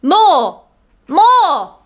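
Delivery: high, insistent cry. A narrator's reading. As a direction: cry out high, each word falling away. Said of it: "he cried".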